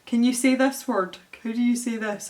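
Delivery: usual speaking voice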